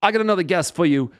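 imitating an emcee's high, quick introduction; club host announcing. Host springing a surprise guest on the crowd, high-pitched announcing. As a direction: build up to the big name. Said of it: "The host comes out and says".

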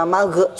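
magh released as 'ma-ge'; bouncing gh. Shown as a demonstration. Teacher warning learners, echoed bounce at the end of magh. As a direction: pop a short vowel after magh. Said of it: "suara pantulan ya".

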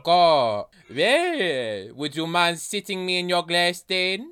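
put-on banana asking in a French accent. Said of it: "focused on this accent".

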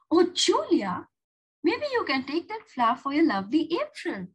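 light up with a sudden idea; bright idea. Acted. Julia to herself as it clicked, eager realization.

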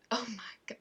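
unvoiced whisper of dismay. Real, not acted. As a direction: whisper it under your breath. Botches it a third time.

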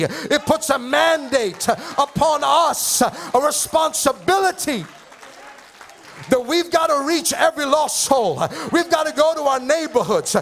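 impassioned pulpit proclamation; full-force preaching. Real, not acted. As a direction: preach it with rising urgency, stretching the key phrases.

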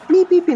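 high, loud beep beep of bus doors. Retold or imitated. Boy imitates the bus doors opening, high beeping. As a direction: mimic the bus door chime.

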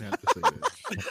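laughing. Real, not acted. Laughing mock apology.

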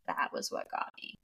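faint breathy whisper; drugged, fading whisper of realization. Retold or imitated. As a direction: whisper it as if losing strength. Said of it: "She's like".

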